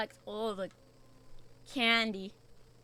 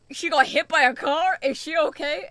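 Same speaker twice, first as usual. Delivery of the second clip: very loud, higher mock alarm; alarmed chat reaction; 5 semitones higher, 10 dB louder than usual. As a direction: imitate a crowd's shocked questioning. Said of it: "Everyone was like".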